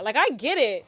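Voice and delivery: ordinary talk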